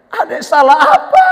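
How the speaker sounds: high, plaintive protest; mock hurt little brother's appeal; Joseph. Joseph asks his brothers why, mock plaintive. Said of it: "Mungkin Yusuf bilang".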